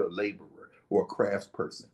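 ordinary delivery

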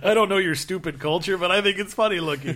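high, loud, laughing bemusement; Aquaman laughing. Retold or imitated. Aquaman laughing at a joke he doesn't get, loud and high. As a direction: laugh through it like a puzzled Aquaman. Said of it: "He's like".

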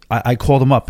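usual speaking voice

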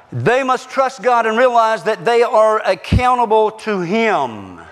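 proclaiming preacher; forceful preaching. Real, not acted. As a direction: preach it out to the whole congregation.

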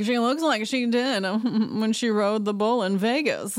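lightly voiced catty dig with a laugh; mock catty Kyle. Kyle mocking Sutton's riding in confessional, snide. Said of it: "She's like".

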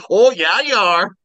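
high, loud Minnesotan agreement; Dave. Retold or imitated. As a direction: cheer on a buddy in Minnesota dialect. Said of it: "And Dave was like".

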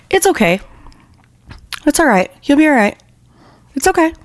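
mock-sweet high coo, mimicking a killer's fake comfort; mock soothing woman. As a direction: imitate a false, cooing comforter.

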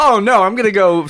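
usual speaking voice

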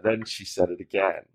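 ordinary talk

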